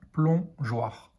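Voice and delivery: "plongeoir" as plon-geoir, with a gap; split into syllables. Shown as a demonstration. Teacher showing pupils the syllable heard, word split in two. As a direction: pause between the syllables of "plongeoir". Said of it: "on entend la syllabe".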